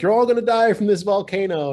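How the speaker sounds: high, loud hammed-up proclamation; mock doom warning. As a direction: shout a mock warning to a whole crowd. Acts out Loki warning Pompeii's people.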